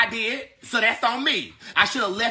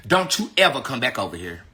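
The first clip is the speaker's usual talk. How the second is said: ordinary talk, nothing marked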